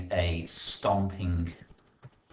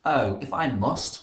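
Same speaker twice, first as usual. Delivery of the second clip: grumpy grunt; 5 semitones higher, 6 dB louder, slower than usual. Acted. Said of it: "grunted Red angrily".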